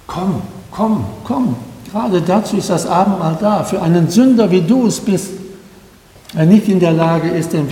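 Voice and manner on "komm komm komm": soft, coaxing come; a quiet repeated invitation to come; gentle inviting call. Real, not acted. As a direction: beckon the listener tenderly. Pastor inviting sinner to communion.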